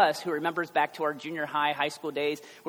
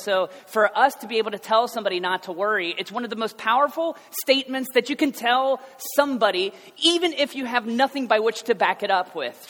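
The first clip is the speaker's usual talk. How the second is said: higher and louder, driving the point home; full-force preaching; 7 semitones higher, 8 dB louder than usual. Acted. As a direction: preach it with rising urgency.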